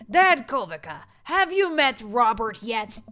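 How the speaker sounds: loud, jovial neighborhood dad voice; big, chummy dad voice; hearty neighbor dad. Acted. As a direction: boom out a hearty greeting. Hearty neighbor Brian introduces Robert.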